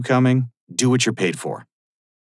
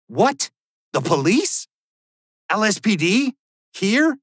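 much higher, rising startled questions; shocked disbelief; 9 semitones higher than usual. Acted. Told the police are at his house, shocked high questions. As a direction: jolt up in alarm at each word.

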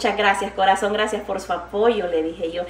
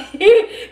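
a loud, short real laugh; 9 semitones higher, 7 dB louder than usual. Real, not acted. Laughing after her line.

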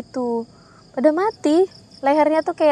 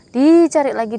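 ordinary talk

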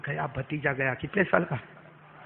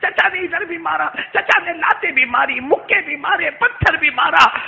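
crying; 10 semitones higher, 10 dB louder than usual. Acted. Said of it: "रोते रोते कहते".

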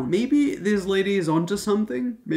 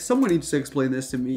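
ordinary delivery

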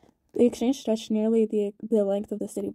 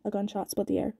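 ordinary delivery, nothing marked

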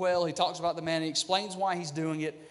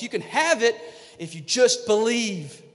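louder, slow plea to just believe; God urging loudly, drawn out; emphatic call; 4 semitones higher, 7 dB louder than usual. Acted. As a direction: call it out so they finally hear. God calling to circling people.

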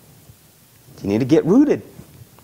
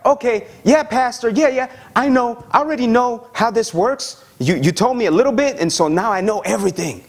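higher, cocky know-it-all; 6 semitones higher than usual. Retold or imitated. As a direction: brush off the pastor, pleased with yourself. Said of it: "they're like".

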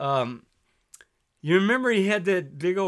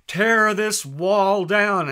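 higher, louder, very slow proclamation; Reagan's famous line; 6 semitones higher, 8 dB louder, much slower than usual. Retold or imitated. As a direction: boom it out like a president at a wall. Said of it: "I still remember Reagan".

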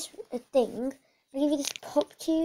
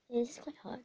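whispered aside; 8 dB quieter, faster than usual. Acted. Aside while squeezing the trade goods.

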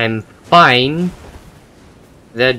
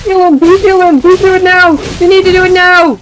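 shouting; 10 dB louder, faster than usual. Acted. Gleeful game villain shouting.

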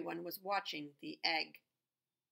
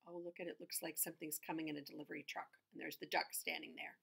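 plain reading voice

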